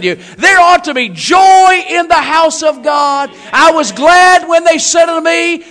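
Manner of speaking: loud, impassioned preacher building up; rousing sermon shout; full-force preaching. Acted. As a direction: proclaim it to the congregation with long stressed words.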